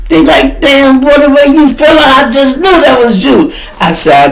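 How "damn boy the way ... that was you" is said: high, loud hyped-up voice; excited bystander. Retold or imitated. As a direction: gush at him like a stranger who saw it. Bystanders react.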